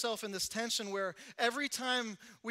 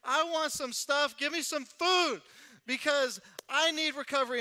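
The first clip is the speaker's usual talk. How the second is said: louder, higher, slowed-down plea for more; hungry plea; 5 semitones higher, 6 dB louder than usual. Acted. Preacher to congregation, loud hungry plea. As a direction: demand it like a starving man. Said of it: "I'm hungry tonight".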